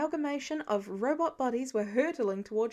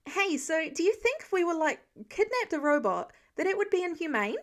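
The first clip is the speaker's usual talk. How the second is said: put-on higher, eager kid's chatter, quick at the end; curious joy; 6 semitones higher, 3 dB louder than usual. A narrator's reading. Kid rambling to Clint mid-battle, eager and higher. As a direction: bubble over with curious joy. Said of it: "curious joy in the kid's voice".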